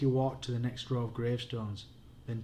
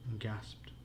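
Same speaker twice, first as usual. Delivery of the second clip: ordinary delivery, nothing marked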